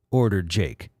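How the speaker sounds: ordinary talk, nothing marked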